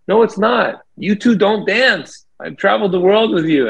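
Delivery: high-pitched mock shouting at the band; retold heated argument. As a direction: relive the studio fight, pitch way up. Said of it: "we're all screaming at each other".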